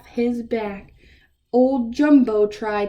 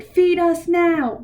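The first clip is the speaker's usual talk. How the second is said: hollering raccoons; 6 semitones higher, 4 dB louder than usual. Acted. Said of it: "The raccoons hollered".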